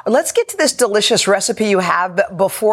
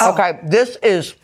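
mouth full; slower than usual. Real, not acted. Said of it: "sorry to talk with my mouth full".

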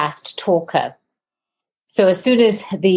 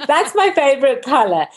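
laughing through the words, pitched up; 12 semitones higher than usual. Real, not acted. Host laughing as she tells Peggy her favorite color. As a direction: giggle the line out at your own joke.

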